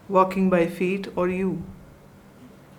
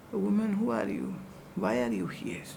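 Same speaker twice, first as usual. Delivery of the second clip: detached and soft; 10 dB quieter, faster than usual. Retold or imitated. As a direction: say it coolly and unattached, almost to yourself. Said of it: "Swami Ramtirth addressed her like".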